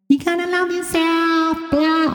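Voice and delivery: high, drawn-out mocking sing-song; mock well-meaning woman. Mimicking a woman's TikTok self-love advice, high mocking drawl. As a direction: stretch it out high, parodying her advice. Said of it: "this woman's best intended going".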